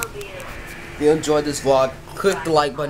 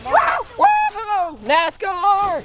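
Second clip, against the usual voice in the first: excited cheering; 3 dB louder, much slower than usual. Real, not acted. Cheering pool jumps.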